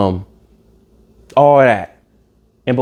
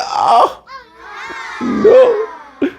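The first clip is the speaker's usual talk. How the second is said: mock sobbing; much slower than usual. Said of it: "he started crying".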